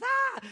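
high, loud mock boo; grandma's playful high scare cry; playful jump-scare shout. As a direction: leap out at the kids with a high shout. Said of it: "and she says".

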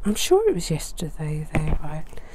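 soft whispered aside; low confiding whisper to viewers. Real, not acted. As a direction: murmur it half to yourself. Quiet aside while unpacking.

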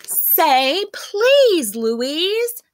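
high, loud plea with a cry in it; the others pleading with Louise, near crying; tearful pleading. A narrator's reading. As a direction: beg her almost in tears. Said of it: "the others pleaded".

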